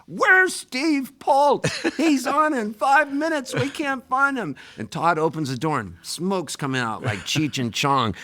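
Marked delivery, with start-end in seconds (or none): 0.1-4.5 high, drawn-out frantic shout down a hallway; retold scream. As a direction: yell it like a panicked stage manager. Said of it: "there was this scream in the hallway".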